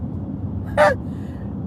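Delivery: a high, breathy burst of laughter. Real, not acted. Laughing at the Baltimore threat.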